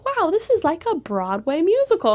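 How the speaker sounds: high-pitched imitation of wowed family; amazed. Family raving to the performer about the show, amazed.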